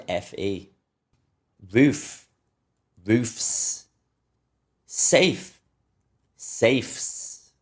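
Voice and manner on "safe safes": usual speaking voice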